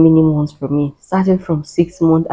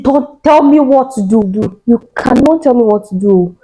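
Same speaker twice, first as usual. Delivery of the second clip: defiant retort; 5 semitones higher than usual. Retold or imitated. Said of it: "Allow me to shout my shouts".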